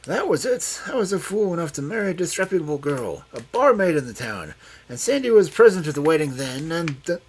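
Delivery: put-on high, loud confessing old man; feverish confession. A narrator's reading. Old man confessing to Loveday, high and loud. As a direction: blurt it out feverishly.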